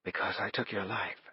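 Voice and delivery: very soft, hoarse woman's voice; husky near-whisper of guilt. A narrator's reading. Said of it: "her voice was hoarse".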